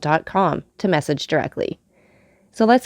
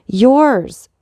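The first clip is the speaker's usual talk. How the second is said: a raised, higher cry of the name; cried out; 6 semitones higher, 6 dB louder than usual. Acted. Veiled woman crying out to the Count, raised voice. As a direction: fling the word out at him. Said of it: "cried she".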